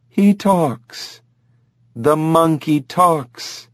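can't believe her eyes; stunned disbelief. Acted. Lynn staring at the talking chimp, stunned.

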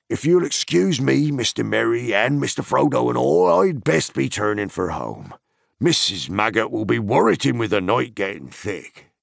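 loud, high rustic farmer voice; gruff old farmer. A narrator's reading. Farmer explaining to Merry before heading home, loud and rustic. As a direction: grumble kindly, eager to get home.

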